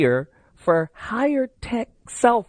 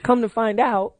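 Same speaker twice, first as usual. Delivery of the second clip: ordinary delivery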